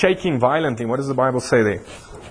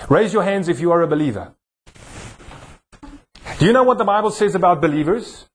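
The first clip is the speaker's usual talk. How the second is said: usual speaking voice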